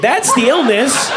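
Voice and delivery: ordinary delivery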